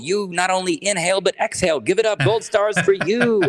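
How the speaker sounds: high, lilting hype-up introduction; mock morning intro. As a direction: introduce the listener like a champion waking up. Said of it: "where Rick's voice, I won't pr-, do your voice, but was like".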